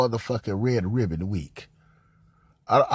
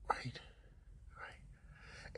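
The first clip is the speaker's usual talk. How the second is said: fading to a breathy whisper; 23 dB quieter than usual. Real, not acted. Host reacting to the call, dropping to a whisper. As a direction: let it trail into a whisper. Drop to whisper after point.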